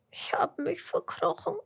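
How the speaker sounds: tiny high tearful worm voice; gespieltes Schluchzen. A narrator's reading. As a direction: play a little worm sobbing, high and whispery. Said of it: "schluchzt der Regenwurm". (hoch und weinerlich schluchzend)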